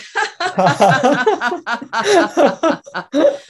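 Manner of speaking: big belly laugh out loud; hearty laugh. Shown as a demonstration. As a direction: laugh it all out, long. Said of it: "now just laugh it out".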